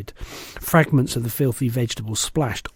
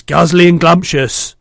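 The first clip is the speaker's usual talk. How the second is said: louder shouting giant; shouted; 5 semitones higher, 11 dB louder than usual. A narrator's reading. Bloodbottler shouting at the BFG. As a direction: shout it back. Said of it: "shouted the blood bottler".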